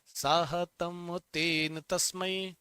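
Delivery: slow, deliberate chant of साहतम तेन तस्मै; slow recitation. Shown as a demonstration. Teacher prompting students to repeat, chanting slowly. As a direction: lead the class through it slowly. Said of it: "एक वचन कैसे चलेगा".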